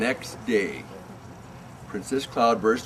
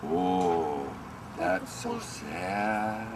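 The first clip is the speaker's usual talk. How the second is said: soft, slow, lower pity; mock pity; 6 semitones lower, 7 dB quieter, much slower than usual. Imitating onlookers' pity at the tantrum, slow and soft. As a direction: drag out a sorry sigh. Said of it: "everybody else thinks".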